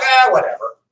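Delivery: laughing, careless British brush-off; hammed-up smug redcoat, laughing it off; mock dismissive. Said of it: "you know, they're like".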